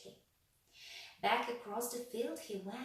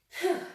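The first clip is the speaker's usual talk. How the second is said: relieved phew; 9 semitones higher, 13 dB louder than usual. A narrator's reading.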